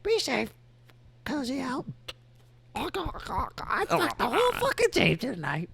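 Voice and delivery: put-on high, cackly old granny voice; foul mouthed granny. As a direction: cuss like a naughty grandma. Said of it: "in the grandma voice".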